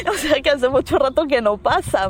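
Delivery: high laugh running into the words. Real, not acted. Laughing as she explains the ghost's answer to her co-host.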